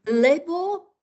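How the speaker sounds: Lebo pitched high and held, stress on le. Shown as a demonstration. Teacher gives the answer word to the class, high and marked. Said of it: "The answer will come".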